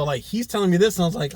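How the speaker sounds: normal talking voice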